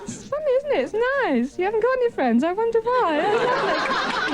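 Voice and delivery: put-on high, patronising children's-party voice; mock cooing. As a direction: talk down to him with gushing pretend kindness. Talking down to audience man.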